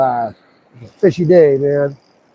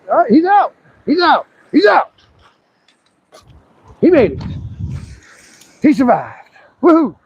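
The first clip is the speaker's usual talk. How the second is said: excited cheer; 9 semitones higher than usual. Real, not acted. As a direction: celebrate the fish swimming off. Fish released, swims away.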